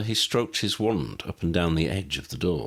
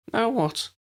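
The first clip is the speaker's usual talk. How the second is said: lightly voiced Lydia. A narrator's reading.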